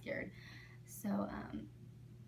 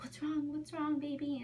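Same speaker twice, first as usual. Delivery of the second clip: worried mom; 6 semitones higher than usual. Retold or imitated. Mother worried for daughter.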